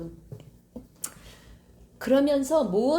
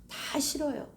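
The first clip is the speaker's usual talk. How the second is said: ordinary talk, nothing marked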